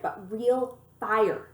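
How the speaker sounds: plain reading voice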